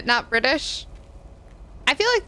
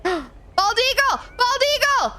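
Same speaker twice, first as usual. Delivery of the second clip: gasp, then call out much higher and slowly; excited calling out; 13 semitones higher than usual. Real, not acted. Spotting a bald eagle.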